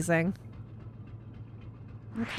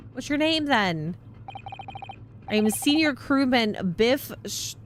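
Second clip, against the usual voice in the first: usual speaking voice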